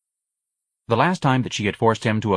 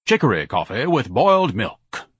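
lofty, higher-pitched pronouncement; grand; 4 semitones higher than usual. Acted. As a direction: order grandly, like someone important. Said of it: "Ignatius said grandly".

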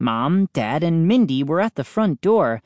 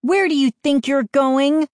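sister's voice; 11 semitones higher, 4 dB louder than usual. A narrator's reading. Sister catching him sneaking out.

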